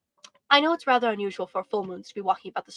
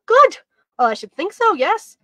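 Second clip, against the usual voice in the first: delighted; 8 semitones higher, 9 dB louder than usual. Acted. Boy delighted by praise.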